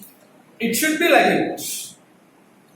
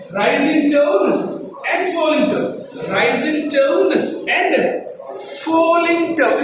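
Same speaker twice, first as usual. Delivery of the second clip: "rising tone and falling tone" slow, with swooping pitch; drawn-out English tune on "rising tone and falling tone"; rising, falling intonation; 2 semitones higher, slower than usual. Shown as a demonstration. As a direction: exaggerate the rise and fall on "rising tone and falling tone". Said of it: "But in English, you have".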